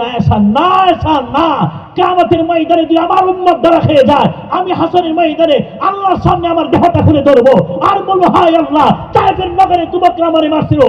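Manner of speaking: high, sustained pulpit cry; soaring, chanted-style sermon outcry; impassioned preaching climax. Acted. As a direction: wail out the plea as a preacher at full pitch. Preacher voices Prophet's plea at climax.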